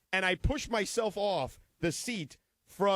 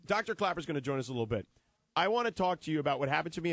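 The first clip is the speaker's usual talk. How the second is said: normal talking voice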